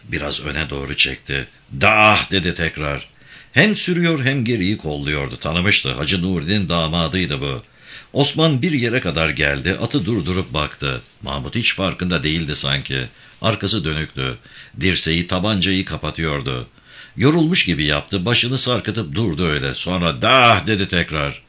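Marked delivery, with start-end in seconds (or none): 1.8-2.3 louder, higher herding cry; farmer's command to his animals; driving oxen. A narrator's reading. 20.2-20.7 loud, higher herding cry; farmer's command to his animals; driving oxen. A narrator's reading.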